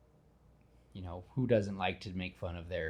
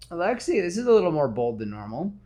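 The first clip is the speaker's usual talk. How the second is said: mock Spider-Man; 8 semitones higher, 7 dB louder, faster than usual. Spider-Man teases criminal.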